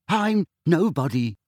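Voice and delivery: hurried. A narrator's reading. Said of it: "said Neville hurriedly".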